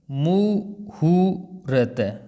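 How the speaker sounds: मुहूर्त very slowly, "mu... hu... rt"; syllable breakdown. Shown as a demonstration. Teacher dictates the spelling, broken into syllables. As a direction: pause between each syllable of मुहूर्त. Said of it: "हु दोनों ही छोटे हैं".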